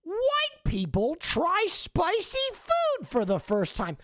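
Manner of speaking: slow, high-pitched hyped-up title voice; mock video title. YouTuber parodying old video premises, slow high mock-hype voice. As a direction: announce a silly video premise, drawn out and high. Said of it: "Everything was like".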